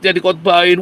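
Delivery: usual speaking voice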